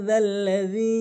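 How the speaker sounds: জাল্লাজি with the doubled lam and zal; correct form. Shown as a demonstration. Teacher giving the correct pronunciation, doubled lam. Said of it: "সঠিক উচ্চারণ হচ্ছে".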